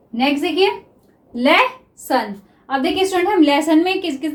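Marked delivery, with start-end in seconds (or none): 1.3-2.3 officer drawn out very slowly; teacher dictating officer to students; slow dictation. Shown as a demonstration. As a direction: stretch officer as if writing it down. Dictating while writing shorthand.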